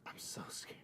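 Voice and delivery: mock scared whisper. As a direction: whisper it like frightened.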